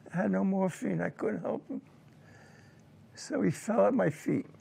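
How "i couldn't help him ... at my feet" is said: usual speaking voice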